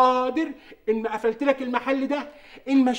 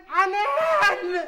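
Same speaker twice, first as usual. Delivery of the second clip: drawn-out call; 5 semitones higher, much slower than usual. Acted. Calling into the phone.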